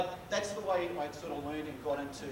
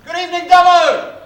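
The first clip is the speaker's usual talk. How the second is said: big shouted greeting; 12 semitones higher, 21 dB louder than usual. Real, not acted. Comedian greeting the Dubbo crowd with a long roaring shout. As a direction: bellow it to the back row, stretching Dubbo.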